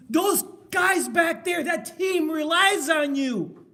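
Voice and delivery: loud, high, slow rallying shout; coach's shouted appeal. Retold or imitated. Film coach to player about team, rousing shout. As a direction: shout it slowly to stir the player.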